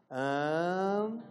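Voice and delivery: An held as one nasal vowel, mouth closing; a drawn-out nasal an, lips closing at the end; nasal an demo. Shown as a demonstration. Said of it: "experimenta dizer assim, ó, o som an". (um an nasal prolongado, fechando a boca)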